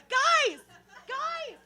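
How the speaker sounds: high pleading calls; a kid calling out to friends who laugh. Retold or imitated. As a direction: plead with them in a high whine. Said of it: "And I'm like".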